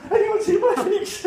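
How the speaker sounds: high, loud call for the pigs. Retold or imitated. Pig owner asks the townsfolk, high and loud. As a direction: call out asking after the pigs. Breathless man looking for pigs.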